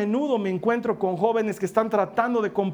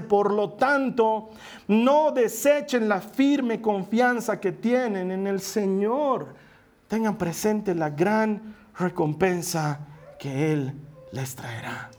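slow, hushed reading of the verse; solemn scripture reading; much slower than usual. Acted. Pastor reading Hebrews to the congregation, slow and hushed. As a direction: read it slowly and solemnly.

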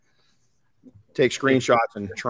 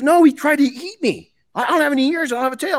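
high-pitched indignant donkey voice; protesting donkey; 14 semitones higher, 4 dB louder than usual. Acted. Donkey protesting in retold fable.

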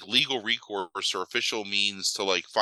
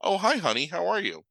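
sudden sweet granny; 8 semitones higher than usual. Retold or imitated. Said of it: "the mother's like".

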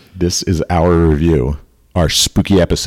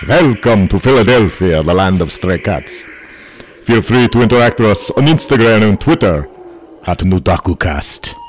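mock Dracula welcoming listeners to his castle; 5 semitones higher than usual. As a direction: play the spooky castle host, stretching words. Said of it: "Count Dracul".

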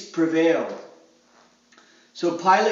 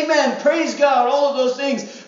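higher, louder cheer of amen; mock excited praise; 5 semitones higher, 4 dB louder than usual. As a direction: play the eager churchgoer cheering. Congregation praising after testimony.

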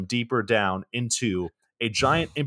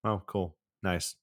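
softer, deadpan unimpressed reactions; flat unimpressed; 2 semitones lower, 7 dB quieter, slower than usual. Retold or imitated. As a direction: sound totally bored by it. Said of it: "I was like".

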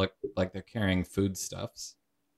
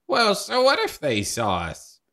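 higher, louder dismissive ghost voice; breezy ghost; 12 semitones higher, 6 dB louder than usual. Acted. Ghost brushing off the sighting, high and airy. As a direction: shrug it off as a gift.

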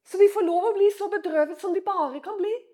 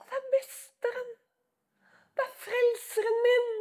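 higher, breathless whisper of joy; whispered recognition; 5 semitones higher, 4 dB quieter than usual. Acted. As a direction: gasp it out, recognising the master. Disciple recognises Jesus. (hviskende gjenkjennelse)

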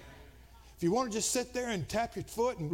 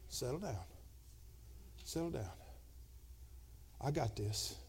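softly calming, lower and hushed; the Spirit soothing an angry man, a quiet hush; 8 semitones lower, 12 dB quieter than usual. Retold or imitated. The Holy Spirit calming the preacher, softly soothing. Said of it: "the Holy Spirit quiets that".

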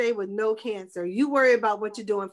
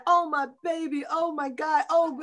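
mock pitying mother; 5 semitones higher than usual. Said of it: "If she had pitied me".

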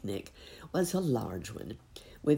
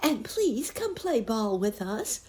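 put-on high piggy-boy voice, a light grunt; piggy boys asking to play ball, lightly grunted; 10 semitones higher, 3 dB louder than usual. A narrator's reading. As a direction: grunt it out like a little pig. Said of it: "grunted Curly and Floppy Twisty Tail".

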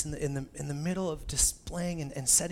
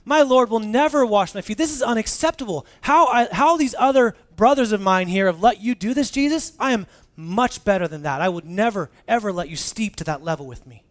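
high, loud, indignant Peter; brash Peter protesting loudly; Peter with gusto; 6 semitones higher, 10 dB louder than usual. Acted. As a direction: refuse him with full bluster. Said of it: "with that same gusto that Peter said".

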